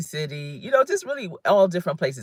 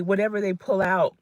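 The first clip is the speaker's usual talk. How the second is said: normal talking voice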